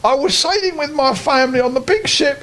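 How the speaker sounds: a lost little teddy telling his woe; teddy bear voice. Acted. Teddy bear tells his story.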